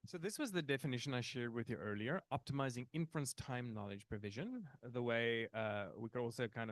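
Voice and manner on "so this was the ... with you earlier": ordinary talk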